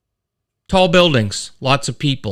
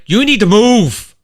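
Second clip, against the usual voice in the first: loud, higher, snapping at the driver ahead; mock road rage; 7 semitones higher, 5 dB louder than usual. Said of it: "a little road rage going on".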